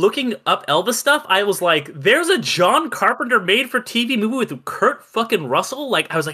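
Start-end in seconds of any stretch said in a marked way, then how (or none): 1.9-5.9 high-pitched incredulous outburst; pitched up, can't believe it exists; astonished. Retold or imitated. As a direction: retell it in amazed disbelief. Retells own astonished discovery.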